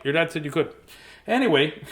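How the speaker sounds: ordinary talk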